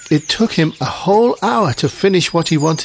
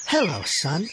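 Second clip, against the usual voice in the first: soft motherly greeting; gentle, soft-spoken mum; mother character; 7 dB quieter than usual. A narrator's reading. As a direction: answer your son quietly. Mum replies.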